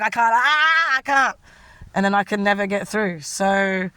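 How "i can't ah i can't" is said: distressed. Retold or imitated. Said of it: "I'm like".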